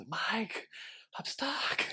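urgent whispered yell. Retold or imitated. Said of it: "whisper screaming through the cracks".

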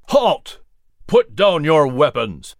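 loud commanding shout. Acted. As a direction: bark the order to stop. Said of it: "Washington shouted".

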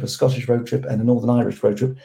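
ordinary delivery

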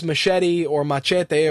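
Machete said first English-style, then Spanish-style; two pronunciations. Shown as a demonstration. Host offering listeners both ways to call the film, Machete pronounced two ways. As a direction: say Machete as muh-SHET-ee, then as mah-CHEH-tay. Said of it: "or however you wanna call it".

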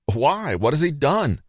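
high, pleading question; storyteller's startled cry; shocked outcry. A narrator's reading. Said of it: "Pilate cried out".

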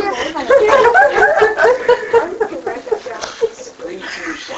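long high laugh. Real, not acted. Laughing at the pun remark.

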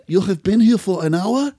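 imitating the drunk neighbour's Eastern European English; Eastern European accent. Neighbour asking a question about his fallen friend, in a mock Eastern European accent.

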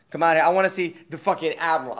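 usual speaking voice

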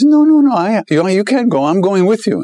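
high, insistent no-no-no; pleading sadhu. Retold or imitated. Sadhu refuses to be left.

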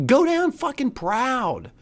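normal talking voice

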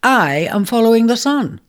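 high, proud pronouncement; self-important. Acted. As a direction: puff up and declare it grandly. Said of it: "with arresting self-importance declared".

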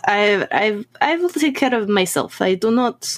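put-on deferential Russian-accented assurance. Assuring Elsa she won't feed, Russian accent. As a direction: reassure your host in a Russian accent. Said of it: "German with a Russian accent".

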